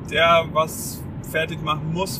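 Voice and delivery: ordinary talk, nothing marked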